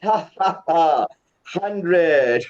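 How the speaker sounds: deliberate laugh around a drawn-out hundred; stretched hundred capped with ha-ha laughs; laughter exercise. Shown as a demonstration. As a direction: finish the count in staged laughter. Laughing finish to the warm-up count.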